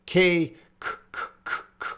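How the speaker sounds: K, k, k said as a voiceless puff with no voice; repeated unvoiced k; k sound demo. Shown as a demonstration. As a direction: whisper the k sound with no vibration. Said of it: "So is".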